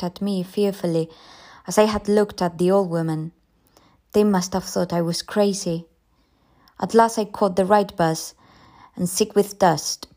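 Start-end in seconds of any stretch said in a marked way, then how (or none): none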